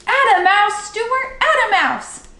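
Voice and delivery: high, loud cheering shout; cheering crowd call. A narrator's reading. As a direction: cheer him on from the shore. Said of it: "people cheering for him and calling".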